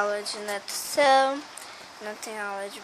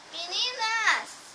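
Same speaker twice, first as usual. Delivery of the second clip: calling out; 10 semitones higher, much slower than usual. Acted. Calling out to her friends.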